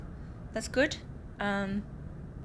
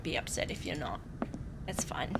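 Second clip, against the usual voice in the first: whisper; 9 semitones lower, 12 dB quieter than usual. Real, not acted. Reassuring viewer, whispered aside.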